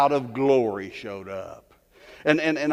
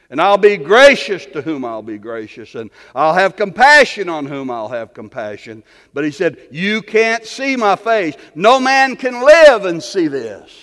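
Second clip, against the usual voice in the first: preacher thundering God's words; booming sermon delivery; 5 semitones higher, 12 dB louder than usual. Acted.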